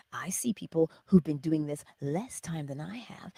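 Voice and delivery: normal talking voice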